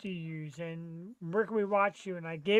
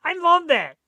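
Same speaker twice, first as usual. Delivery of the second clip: excited; 9 semitones higher, 9 dB louder than usual. Real, not acted. Excited reaction to music.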